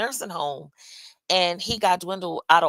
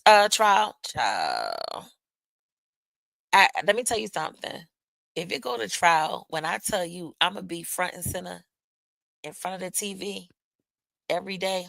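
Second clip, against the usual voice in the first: usual speaking voice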